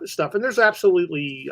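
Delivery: usual speaking voice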